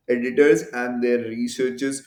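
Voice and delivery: ordinary talk, nothing marked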